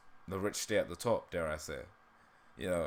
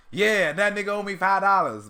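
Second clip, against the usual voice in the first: loud, high-pitched cartoon character attempt; attempted character impression; 9 semitones higher, 7 dB louder than usual. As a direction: belt it out high as a rough impression. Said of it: "do it kind of in the voice that he does".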